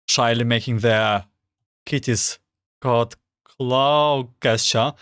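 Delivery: usual speaking voice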